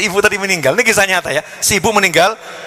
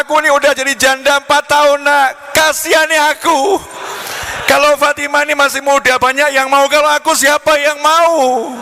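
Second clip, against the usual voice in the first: pleading widow; 11 semitones higher than usual. Acted. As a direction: beg him hammily, half laughing. Widow mother voiced begging the suitor.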